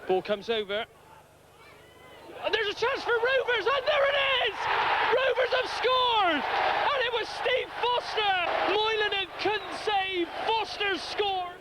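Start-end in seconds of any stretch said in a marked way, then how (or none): 2.4-11.5 long goal yell. Real, not acted. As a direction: scream the goal for the home fans. Commentator calls Rovers goal.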